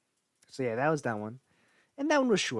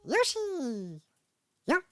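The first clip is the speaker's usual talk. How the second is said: much higher, louder squeaky Yoshi cheer; Yoshi happy sound; 6 dB louder, much slower than usual. Retold or imitated. Copying Yoshi's happy sound for the viewers. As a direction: cheer like the happy little dinosaur. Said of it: "Yoshi, happy sound".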